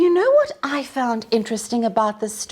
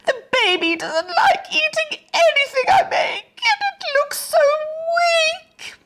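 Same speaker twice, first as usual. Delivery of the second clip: wailing. Acted. Mother fretting that her baby looks weak, high tearful wail. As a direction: let her voice break into a high sob. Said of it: "wailed Missus Hargraves".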